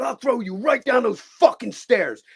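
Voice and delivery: high, drawn-out furious threat; angry threat. Retold or imitated. Acts out threat to the actor.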